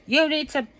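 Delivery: retold yelling. Husband scolding her for overreaching, mild mock yell. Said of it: "Jimmy's yelling at me".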